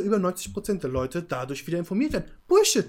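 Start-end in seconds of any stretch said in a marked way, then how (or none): 2.5-2.9 sudden loud high shout; loud scoffing exclamation; angry outburst. Real, not acted. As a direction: blurt it out in disgust. Heated debate outburst.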